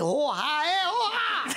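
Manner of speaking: high strangled cries through laughter; choking gasps. Acted. Teller redoing the dying man's gasps, laughing. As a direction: gasp it out as if crushed.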